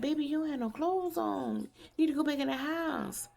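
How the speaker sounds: put-on high-pitched talking to a little child; retold concern for toddler. Telling a naked toddler to go home, retold in a high voice. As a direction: raise the pitch as if coaxing a toddler.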